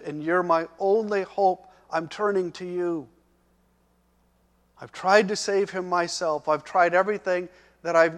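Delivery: loud, urgent, at times fast; desperate pleading. Acted. Father begging Jesus for his son, loud and urgent. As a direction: pour out every last hope. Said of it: "essentially he was saying".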